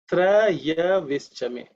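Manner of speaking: "Trayavishchame" very slow, opening on a full "tra-ya"; common mispronunciation. Shown as a demonstration. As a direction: stretch out "Trayavishchame" with two syllables in "Traya". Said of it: "That's the way many people pronounce".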